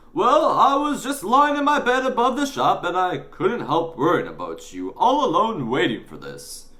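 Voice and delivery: high, loud, swooping voice; worried fussing newsagent. Acted. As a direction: fuss anxiously over the boy.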